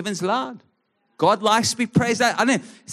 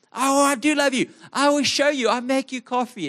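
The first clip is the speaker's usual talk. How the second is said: higher pleading protest; acted argument; 6 semitones higher than usual. Husband protests to wife in their argument, pitched-up pleading. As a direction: insist you love her, pitched up.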